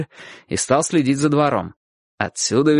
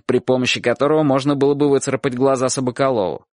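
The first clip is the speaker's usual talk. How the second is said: ordinary delivery, nothing marked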